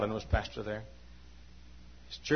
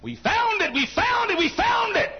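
much higher ringing refrain; triumphant cry; 5 dB louder than usual. Acted. Preacher to the congregation, high triumphant cry. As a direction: sing out the victory.

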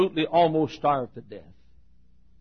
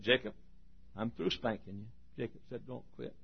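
much softer, low, hushed; hushed God; 7 semitones lower, 18 dB quieter than usual. Retold or imitated. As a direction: lean in and say it softly. God and Jacob, hushed exchange.

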